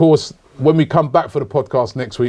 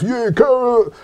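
mouthy fan; 7 semitones higher than usual. Retold or imitated. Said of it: "people giving it all this mouth".

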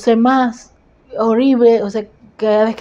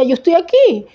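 high-pitched insistent reenactment; retold plea, lifted high; emphatic reassurance; 8 semitones higher than usual. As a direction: raise the pitch sharply to insist on being present. Insists to her crying husband.

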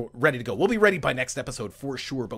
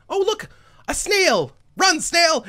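high, loud cry of discovery; high-pitched, delighted exclamation; playful excitement; 7 dB louder, slower than usual. Real, not acted. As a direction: call out to a tiny creature in mock urgency. Spots a snail in the game.

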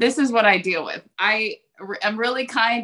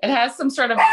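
normal talking voice

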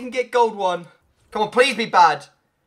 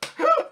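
high delighted laugh. Real, not acted. After the victory.

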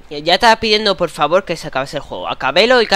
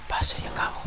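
whisper; 11 dB quieter, faster than usual. Real, not acted. Repeating in whisper.

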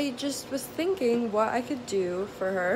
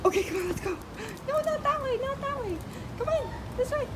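much higher, sing-song coaxing of a pet; high doggy voice; 7 semitones higher, faster than usual. Real, not acted. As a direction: coax the puppy in a squeaky pet voice. Owner coaxes dog in pet store.

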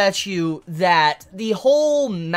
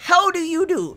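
higher, laughing faux-polite greeting; mock Furuta greeting; 9 semitones higher than usual. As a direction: play the smug troll saying hello through a laugh. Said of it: "He's like".

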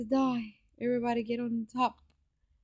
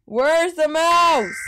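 high, loud, drawn-out cry; loud demand; 8 semitones higher, 9 dB louder, much slower than usual. Real, not acted. Gamer hunting for the mouse, loud demand. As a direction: call it out loud, stretching the words. Frantic searching in game.

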